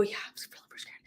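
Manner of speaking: breathless frightened whisper; a scared client whispering back to her coach, timid whisper. Acted. Whisper it, voiceless and afraid.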